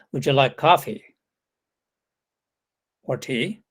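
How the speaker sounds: "coffee" ... long pause, then "tea" falling; falling intonation. Shown as a demonstration. Lecturer demonstrating an alternative offer after a silence, falling intonation. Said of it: "A full stop in C A transcription means falling intonation".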